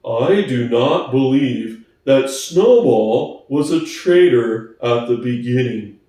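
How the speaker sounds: plodding, honest workhorse; Boxer's slow voice. Acted. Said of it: "he said finally".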